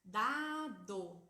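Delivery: put-on each syllable spelled out loud and clear for a child; clear example word. Shown as a demonstration. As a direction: read "dado" out loud as a model. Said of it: "ó, por exemplo".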